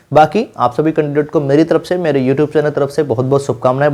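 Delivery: ordinary talk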